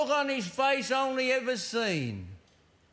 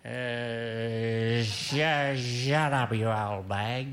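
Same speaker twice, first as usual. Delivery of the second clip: put-on much lower, stumbling retort of a drunk husband; put-upon husband finally snapping at his wife; gruff husband snapping back; 10 semitones lower, much slower than usual. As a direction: drop the voice way down and stammer it out. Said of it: "said her husband".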